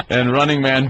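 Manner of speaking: ordinary delivery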